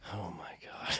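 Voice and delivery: hushed, dreading whisper. Retold or imitated. Future son-in-law asked to saw off an elk's head, whispered dread. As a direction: whisper it under your breath in dread. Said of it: "I was like".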